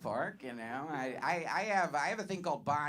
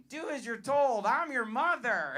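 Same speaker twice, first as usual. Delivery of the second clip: lightly acted stern lady, louder and higher; bossy stepmother; 8 semitones higher, 5 dB louder than usual. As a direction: scold like a commanding mother. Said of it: "there'll be a lady just like".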